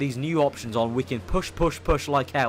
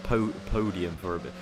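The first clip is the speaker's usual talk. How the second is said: posh voice; 7 semitones lower, 6 dB quieter than usual. Shown as a demonstration. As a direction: say podium like a toff. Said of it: "in a very posh voice".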